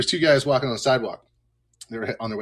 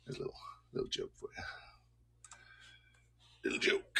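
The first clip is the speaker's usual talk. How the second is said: whispered aside; 16 dB quieter, faster than usual. Real, not acted. Muttered aside after joke.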